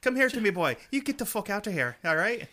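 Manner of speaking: loud, high hammed-up Irish brogue; mock Irishman. Host caricaturing co-host's Irish friend, mock Irish accent. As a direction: summon the lad in a put-on Irish accent. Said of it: "he didn't talk like that".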